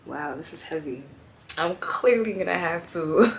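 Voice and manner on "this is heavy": ordinary talk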